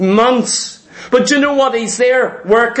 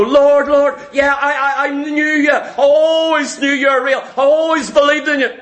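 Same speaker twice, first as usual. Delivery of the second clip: higher, pleading cry to the Lord; a desperate sinner begging at judgment; 4 semitones higher than usual. Acted.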